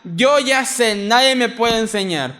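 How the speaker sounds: lightly mock the one who won't be taught; smug know-it-all. Preacher voices an arrogant brother, mock smug boast. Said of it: "y dijera".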